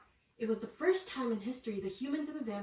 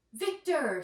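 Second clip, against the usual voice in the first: warm greeting; 4 semitones higher, 7 dB louder than usual. A narrator's reading. Mayor greets Victor.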